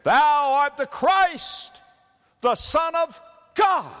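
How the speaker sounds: high, loud, slow cry; demons crying out. Acted. Preacher voicing demons leaving the possessed, high loud slow cry. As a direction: stretch it out like a shrieking demon. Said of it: "crying out and saying".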